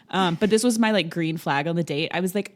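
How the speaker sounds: ordinary delivery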